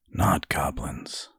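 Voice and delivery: soft, slow, whispery and faraway; hushed, drifting, slow delivery; distant voice. A narrator's reading. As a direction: say it softly, lost in thought. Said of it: "he said in a distant voice".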